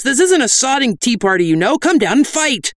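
high-pitched, exasperated shout; out of patience. A narrator's reading. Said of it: "completely out of patience".

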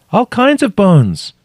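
high, slow, loud reply; calling up. A narrator's reading. Trapped woman calling up.

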